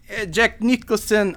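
put-on high-pitched vague European accent; vaguely European accent. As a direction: greet him in a hammed-up European accent. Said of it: "vaguely European accent".

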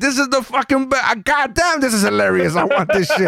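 giddy high excitement at the clip; excited, high. Retold or imitated. Friend gushing about a clip, high and giddy. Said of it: "And I'm like".

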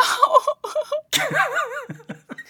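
long, high-pitched laughing fit; high helpless laugh. Real, not acted. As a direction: dissolve into high laughter at yourself. Laughing at her own life.

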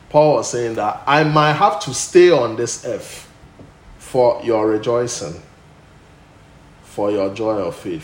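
loud, soaring preacher's voice; full-force preaching. Acted. Pastor thunders to the church about Paul, loud preaching. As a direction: preach it out to the congregation. Preaching Paul's words loudly.

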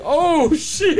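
high, drawn-out mock dismay breaking into laughter; retold shock. Inmate learning it is seventeen years, mock shock. Inmate realising the real sentence.

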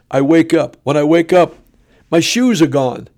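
normal talking voice

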